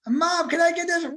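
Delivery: put-on very high pleading child voice; sweet-asking little kid; whiny kid.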